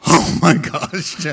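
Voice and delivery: slow, loud groan of mock dread; mock dismay. Husband at wife's invitation to chat, mock dread. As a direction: dread a chat over tea. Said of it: "I was like".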